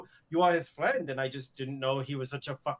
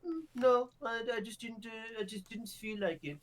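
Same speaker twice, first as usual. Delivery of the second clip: higher whiny mock of Roland; mocking imitation; 9 semitones higher than usual. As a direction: lightly mimic a careless excuse. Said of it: "He was just like".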